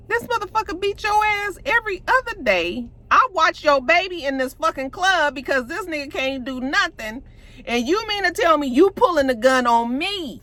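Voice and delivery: high-pitched, wounded outrage in a retelling; indignant disbelief. Retold or imitated. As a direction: confront someone who has betrayed you. Acts out Diamond's hurt outrage.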